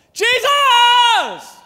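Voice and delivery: a huge, very high and very loud cry of "Jesus!". Retold or imitated. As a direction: yell past everyone telling you to hush. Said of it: "he shouts all the more".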